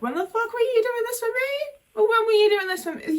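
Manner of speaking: high, indignant accusation; high indignant cry of resentment; bitter outburst. Retold or imitated. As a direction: let out the resentment at a neglectful nan. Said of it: "where I'm like".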